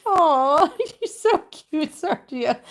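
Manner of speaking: high, loud "aw" over a kitten; gushing pet talk. Real, not acted. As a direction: gush with a laugh, drawn out.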